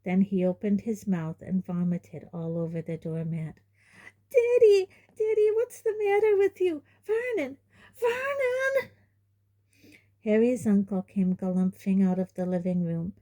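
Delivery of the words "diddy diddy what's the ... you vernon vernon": very high, crying out; wailing for her husband; mother calling husband in panic; panicked mother. A narrator's reading. Petunia panics.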